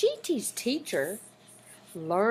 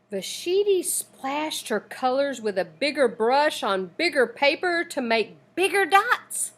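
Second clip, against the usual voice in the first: ordinary delivery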